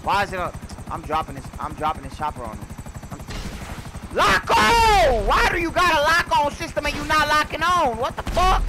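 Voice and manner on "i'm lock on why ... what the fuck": high, slow yell at the lock-on system; furious shout. Real, not acted. Gamer yelling at a broken lock-on system, furious. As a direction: rage at the game as the missile won't lock. Missile won't lock in game.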